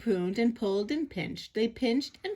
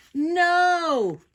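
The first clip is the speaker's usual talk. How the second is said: high, loud crowd yell; big, drawn-out shout; 9 semitones higher, 8 dB louder than usual. A narrator's reading. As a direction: holler a long refusal. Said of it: "the crowd yelled".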